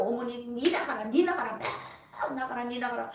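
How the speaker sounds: 목소리를 높여 떠나라고 외치는 호령; 귀신을 쫓아내듯 힘껏 외쳐; shouted command. Retold or imitated. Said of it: "얼마나 씨름했는지". (엄마에게서 마귀를 쫓아내며 외치는 호령)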